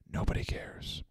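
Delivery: barely voiced whisper; whispered dismissal. Answering the flight attendant's card pitch, whispered dismissal.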